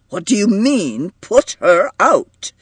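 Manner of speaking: sharp challenge, leaning on the last words; abrupt demand. A narrator's reading. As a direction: demand an explanation, pitch jumping up. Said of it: "demanded John abruptly".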